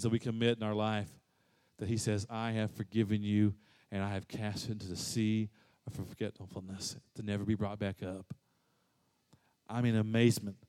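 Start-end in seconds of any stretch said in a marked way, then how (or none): 2.3-8.3 soft voice of God. Acted. God's words voiced softly.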